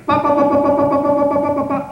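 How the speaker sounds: loud, high mock booms of floors falling; rapid imitated crashes, loud and high; imitated booming pa pa pa. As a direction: pound out each pa like collapsing floors. Imitating collapsing floors sound.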